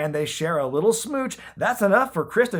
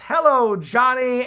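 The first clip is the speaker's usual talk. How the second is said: much higher, louder sultry greeting; 8 semitones higher, 5 dB louder than usual. Retold or imitated. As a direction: purr it like a cougar on the prowl.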